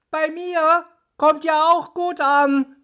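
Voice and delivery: high, sustained call from afar. A narrator's reading. Bob chiming in from down the tunnel, high calling. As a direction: call it out on one high note. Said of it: "Das war Bob".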